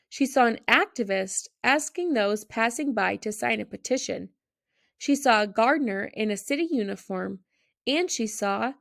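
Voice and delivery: usual speaking voice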